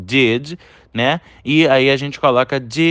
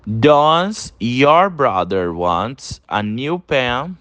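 very slow, careful English model sentence; slow model reading; much slower than usual. Shown as a demonstration. Teacher reading the example sentence to students, very slowly. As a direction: read it out slowly for the class to repeat. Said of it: "Olha a segunda frase de exemplo aí".

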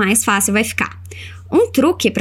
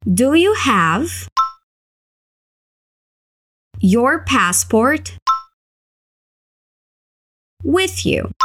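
chunked slow drill; 2 semitones higher, much slower than usual. Shown as a demonstration. Teacher drilling the question in slow chunks. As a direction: Do you have, your passport, with you, slow pieces. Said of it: "vai repetindo comigo".

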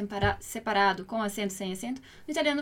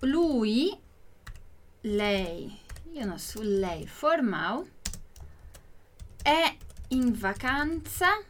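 word by word, with long gaps; slow, spaced-out teaching delivery; slow model reading; 3 semitones lower, much slower than usual. Shown as a demonstration. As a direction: draw each word out for learners to repeat. Teacher breaks the question down slowly.

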